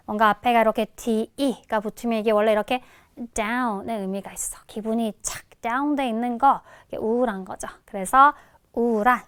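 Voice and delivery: ordinary delivery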